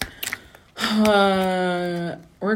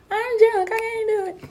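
mock drunk whine. Mocking own drunk excuse.

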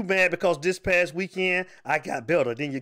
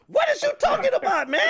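much higher, amazed question; disbelieving squeal. Real, not acted. Host to caller over a tie pick, squealing in disbelief. Reacting to absurd prediction.

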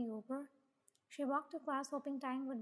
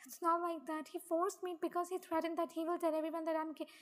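higher, insistent self-defence; urgent pleading; 4 semitones higher, 4 dB louder than usual. Acted. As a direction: explain desperately until cut off. Pleading, then cut off.

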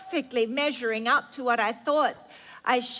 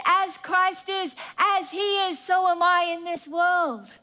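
bold declaration; 5 semitones higher than usual. Acted. Preacher declaring the believer is as Christ. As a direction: proclaim it with bold conviction. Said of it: "And he says".

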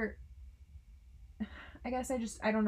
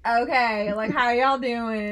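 slow mock Southern "how y'all doing"; drawn-out Southern greeting; demoing accent drift, Southern twang; 11 dB louder, slower than usual. Shown as a demonstration. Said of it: "we transition to".

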